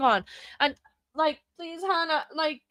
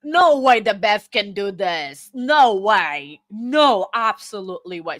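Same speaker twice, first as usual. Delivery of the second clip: louder, low no way; outburst; 6 semitones lower, 6 dB louder than usual. Real, not acted. Streamer reacting to the judge's motion, loud outburst. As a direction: blurt out in disbelief. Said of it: "that got a little bit out".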